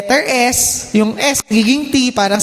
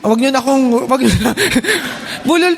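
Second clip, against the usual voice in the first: laughing; faster than usual. Real, not acted. Teasing about the speaker's own speech defect.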